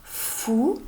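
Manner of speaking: FOU with a long f gliding into ou; blending sounds. Shown as a demonstration. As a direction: draw out "fou" very slowly. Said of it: "f et ou, ça fait". (« fou » en liant lentement f et ou)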